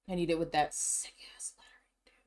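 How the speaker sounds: hushed, breathy whisper to himself. Real, not acted. Vlogger whispering his merch craving to viewers.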